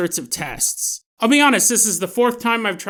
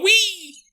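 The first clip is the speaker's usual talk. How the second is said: squeaky wee; 13 semitones higher than usual. Acted. Man shrinks super fast.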